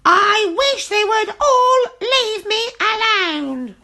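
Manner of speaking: high, loud, slow fed-up witch; exasperated witch. A narrator's reading. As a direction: wail it out in despair. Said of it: "cried the friendly witch".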